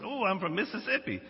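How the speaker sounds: retold excited reply; excited recognition. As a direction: act out pleasantly surprised recognition. Acted surprise of hotel worker.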